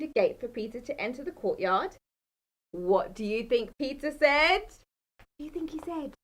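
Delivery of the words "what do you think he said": usual speaking voice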